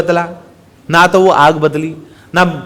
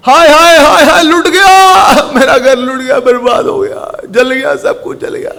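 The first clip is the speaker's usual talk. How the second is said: high, drawn-out lamenting shout; loud wailing; 8 semitones higher, 5 dB louder, slower than usual. Acted. Homeowner sees his house burning, loud wailing. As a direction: wail it at full voice, stretching the cries. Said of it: "जोर जोर से चिल्लाया".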